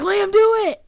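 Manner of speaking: high, loud urging; egging on. Retold or imitated. Friends pushing Liam to scream back, excited egging on. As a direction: goad a friend, acted out.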